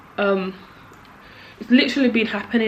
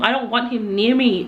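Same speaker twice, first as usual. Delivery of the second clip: normal talking voice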